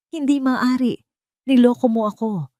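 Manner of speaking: shouting in disbelief at being tricked. A narrator's reading. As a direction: yell it out, stung and betrayed. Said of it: "sigaw ni Miguel".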